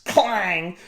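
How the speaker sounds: plain reading voice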